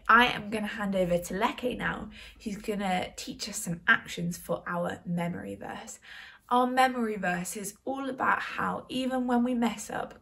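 ordinary talk

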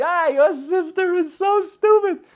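high, loud jeering through laughs; mocking laughter. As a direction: hoot it like laughing teenage boys. Friends laughing uncontrollably.

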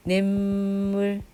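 냇물 as 낸물, nasal held long; drawn-out pronunciation. Shown as a demonstration. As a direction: sustain 낸 slowly before 물 in 냇물. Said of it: "여기, 여기도".

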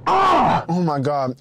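loud blissful moan; ecstatic. Real, not acted. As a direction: savor it out loud. First bite of good food.

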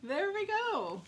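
high, loud, slow singsong; sing-song. Real, not acted. Crafter finding her last card, sing-song. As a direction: sing it out triumphantly.

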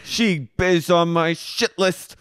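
heated. Retold or imitated. Co-host acting out Katie getting heated in the bar, heated. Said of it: "just getting heated up about Sheena".

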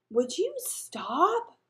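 loud, high, drawn-out demand; exasperated. A narrator's reading. Elaine tells singing Daniel to quit, exasperated. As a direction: stretch it out in loud annoyance.

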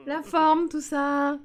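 sing-song welcome to a viewer; cheery mock greeting. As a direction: greet like an overjoyed host. Demos a friendly greeting to viewer.

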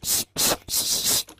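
unvoiced shushing, repeated; whispered shh over and over; teacher's pet shushing. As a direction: hush the class like a prissy pet. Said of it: "we'll go".

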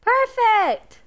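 high, loud cry of delight; delighted squeal. Real, not acted. Owner thrilled at her fish photo, squealing. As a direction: squeal it out with joy.

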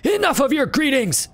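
put-on high angry old beggar; angry snap. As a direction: spit the words back at them. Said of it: "spits back".

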